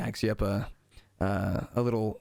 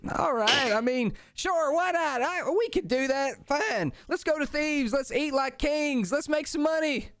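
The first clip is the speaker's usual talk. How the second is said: much higher kobold voice, won over; high kobold agreeing happily; giving in kobold; slower than usual. Acted. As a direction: cave in and get excited.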